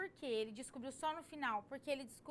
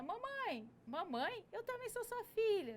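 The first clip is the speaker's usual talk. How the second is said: put-on child voice; 8 semitones higher, slower than usual. Second little girl in dream.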